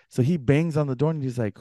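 usual speaking voice

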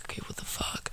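whispered, dazed and confused; whispering like she just woke up wasted; groggy whisper. Retold or imitated. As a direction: breathe it out half-awake. Drunk girl waking up confused.

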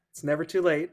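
ordinary talk

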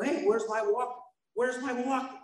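anxious asking. Retold or imitated. Patient looking for his missing walker, high anxious asking.

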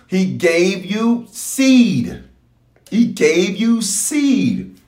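He gave you seed drawn out slowly; slow, deliberate preacher's refrain; slow emphatic preaching. Acted. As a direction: lay each word down slowly, like a sermon point.

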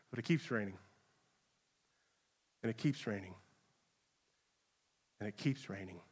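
falling to a near hush; hushed ominous. Acted. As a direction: let the dread sink in quietly. Rain keeps rising, ominous repetition.

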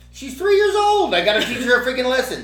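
high, loud protest; retold exasperation. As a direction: plead the case in worked-up disbelief. Acts out his anguished thinking.

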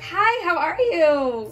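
sweet phone voice. Retold or imitated. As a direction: put on your nicest phone manner. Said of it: "I'd be like".

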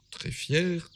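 ordinary delivery

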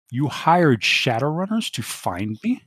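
slow, icy question; colder. Acted. Kimmy questioning Dante, cold and slow. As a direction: chill the air with each word. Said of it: "Her voice is suddenly colder".

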